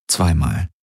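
plain reading voice

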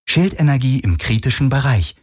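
a hint of impersonal alarm readout; ship computer alert. A narrator's reading. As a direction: announce it as the ship's computer. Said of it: "meldete das Schiff".

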